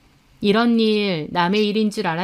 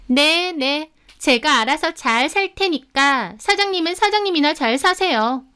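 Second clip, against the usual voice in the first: higher, mocking sing-song retort; sarcastic; 6 semitones higher than usual. A narrator's reading. Rival brushing off the departing narrator, sarcastic. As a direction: sneer it back, pitched up. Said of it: "라고 비아냥대는데".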